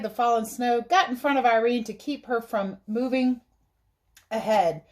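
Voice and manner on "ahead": plain reading voice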